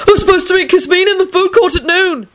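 very high, panicked yelp; desperate yell. A narrator's reading. Young man remembers his noon date, desperate yell. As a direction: cry out in desperation, pitch shot right up. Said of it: "he yelled desperately".

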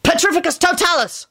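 high, sharp incantation; urgent high-pitched command; snapped spell. A narrator's reading. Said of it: "Lupin snapped".

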